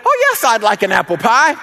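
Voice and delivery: high-pitched delighted neighbor imitation; Miss Evie. Neighbor accepting pie to kids' chagrin, high put-on voice. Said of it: "Miss Evie said".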